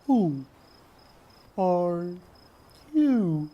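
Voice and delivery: very slow, high and loud, each word stretched; slow, high, dragged-out words; long drawn-out drawl. Acted. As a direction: stretch every word out like a turtle. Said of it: "in a long, drawn-out drawl".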